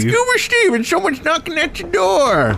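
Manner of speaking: very high cartoon voice; Scuba Steve. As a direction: announce it in a silly squealed falsetto. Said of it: "I do, uh, voices".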